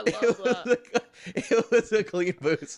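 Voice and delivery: high, loud repeat through laughter; cracking up. Real, not acted. Repeating the pedal's punchline to co-hosts, cracking up. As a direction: drag the words out, helpless with laughter.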